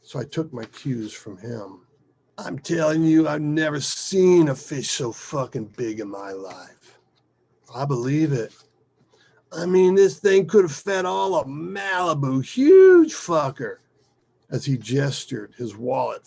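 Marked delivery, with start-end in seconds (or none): 2.4-13.8 loud, higher punches on the big words; excited storyteller. Acted. Mike boasting about a giant fish, excited. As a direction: brag about a monster catch. Mike's tall fish tale.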